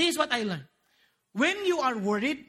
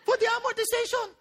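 pleading prayer; 8 semitones higher than usual. Retold or imitated. Car buyer asking the Lord to pay, mock pleading. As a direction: beg the Lord in an exaggerated high voice. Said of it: "Then you would ask the Lord".

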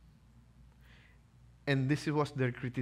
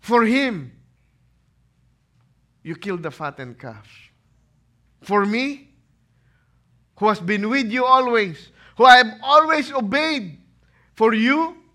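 loud, high, wounded outburst; indignant older brother; 8 semitones higher, 10 dB louder than usual. Acted. Elder son complaining to his father, loud and hurt. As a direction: pour out the grievance loudly.

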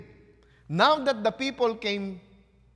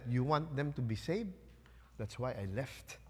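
muttered Jonah; 5 semitones lower, 11 dB quieter than usual. Acted. Jonah's bitter words quoted.

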